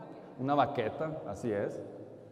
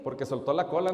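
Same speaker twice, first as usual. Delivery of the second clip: usual speaking voice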